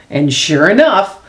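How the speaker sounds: ordinary delivery, nothing marked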